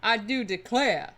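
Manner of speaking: loud, grand Southern gentleman declaring; aristocratic Southerner. Pretend. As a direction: proclaim it like a genteel Southern aristocrat. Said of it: "I am going to be aristocratic Southerner".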